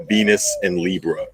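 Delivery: usual speaking voice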